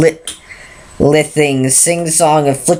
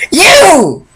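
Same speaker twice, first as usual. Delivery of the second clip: high, loud accusing yell; guard shouting at the stallion, fed up; furious shout; 12 semitones higher, 6 dB louder than usual. Acted. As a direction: fling the word at him. Final outburst at stallion.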